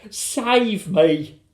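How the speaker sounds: high, loud plea; drawn-out cry for rescue; frightened cry. A narrator's reading. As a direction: wail it out in alarm. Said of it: "she cried as she started going up".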